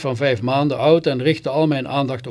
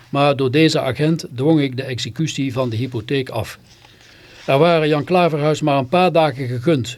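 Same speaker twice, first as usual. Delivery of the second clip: usual speaking voice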